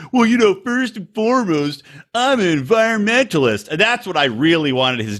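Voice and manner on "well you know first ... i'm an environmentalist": mocking quote. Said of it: "And then he's like".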